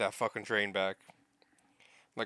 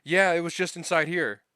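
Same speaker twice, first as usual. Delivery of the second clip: retold calling out; 7 semitones higher, 8 dB louder than usual. Imitates someone calling out the find.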